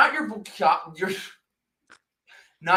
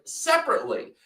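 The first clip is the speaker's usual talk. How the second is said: usual speaking voice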